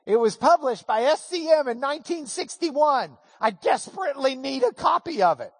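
high, eager plea; eager pleading. Retold or imitated. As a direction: beg for the book excitedly.

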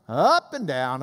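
UP climbs, DOWN sinks low; rise then fall. Shown as a demonstration. Teacher demonstrates the accent, UP AND DOWN contour. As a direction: swoop up on UP, drop on DOWN. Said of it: "This accent meant you go up and down".

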